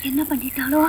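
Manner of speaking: very soft, half-whispered groan; weak, hushed cry of dismay; faint moaning lament. Acted. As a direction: moan under the breath in pain. Burned woman starts to moan.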